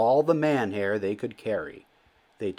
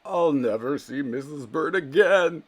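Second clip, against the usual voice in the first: mild crying; 6 semitones higher, 4 dB louder than usual. A narrator's reading. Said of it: "he cried".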